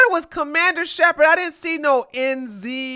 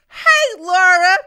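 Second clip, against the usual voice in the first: excited greeting; 6 semitones higher, 6 dB louder, slower than usual. Real, not acted.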